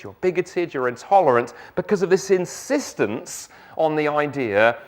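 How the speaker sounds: usual speaking voice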